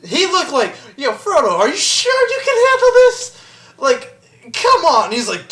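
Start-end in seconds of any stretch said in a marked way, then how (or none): 1.0-5.1 high, doubtful pleading; incredulous mock questioning. Sam doubting Frodo can handle the ring, incredulous. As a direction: question him in mock disbelief, voice climbing high.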